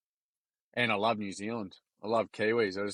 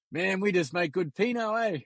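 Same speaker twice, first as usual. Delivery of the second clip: mock Kiwi accent, pitched much higher; teasing Kiwi barman; 7 semitones higher than usual. Rotorua wine shop guy teasing the Aussie customer, high mock Kiwi accent. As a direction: rib the Aussie like a cheeky Kiwi wine seller. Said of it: "He goes".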